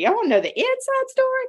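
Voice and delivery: 1 high teasing squeal. Shown as a demonstration.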